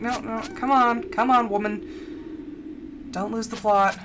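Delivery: lightly put-on Irish accent; slowed, self-chiding Irish accent. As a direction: scold yourself in a slow Irish lilt. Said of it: "the way that I say Irish".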